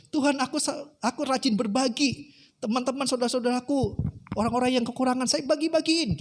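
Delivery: high-pitched acted complaint to God; protesting. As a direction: pile up good deeds in aggrieved tones. (protes bernada tinggi)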